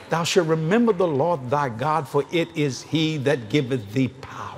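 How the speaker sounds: slow, solemn King James reading; scripture recitation. Acted. Preacher quotes scripture to congregation, solemn and slow. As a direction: intone the verse slowly. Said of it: "scripture quoted".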